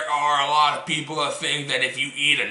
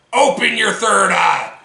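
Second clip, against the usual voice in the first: rousing shout; 5 semitones higher, 5 dB louder than usual. Acted. Rallying cry repeat.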